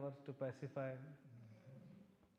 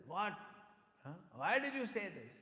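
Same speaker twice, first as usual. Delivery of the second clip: scolding; 4 semitones higher, 6 dB louder than usual. Retold or imitated. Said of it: "And Lalita says".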